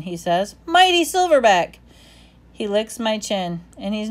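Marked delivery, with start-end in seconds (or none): 0.6-1.8 high, loud encouraging dog voice; cheering dog. A narrator's reading. Bob cheering up Ivan, high and loud. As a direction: pump him up, high and proud.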